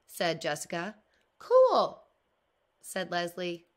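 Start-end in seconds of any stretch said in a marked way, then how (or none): 1.4-2.0 high, loud "cool!"; be wowed by it; impressed exclaim. Acted. Leslie admiring Jessica's collection, high and loud.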